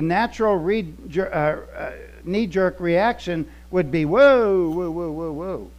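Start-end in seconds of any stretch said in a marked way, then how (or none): 4.0-5.7 mock alarm. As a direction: play the knee-jerk objection, half in jest. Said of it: "the natural knee-jerk reaction".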